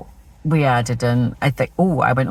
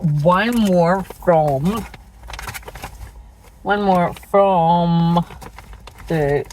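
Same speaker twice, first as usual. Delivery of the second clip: slow drawl; 3 semitones higher, much slower than usual. Real, not acted. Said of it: "drawn-out slow repeat".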